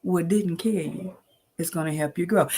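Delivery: usual speaking voice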